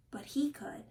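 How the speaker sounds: voiceless whisper; whispered aside. A narrator's reading. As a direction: breathe it like a secret. Secret only he sees.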